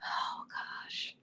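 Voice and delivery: breathy under-the-breath whisper; a private whispered aside; whispered to herself. Real, not acted. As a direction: mutter it to yourself while thinking. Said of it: "oh gosh (whispered, then repeated aloud)".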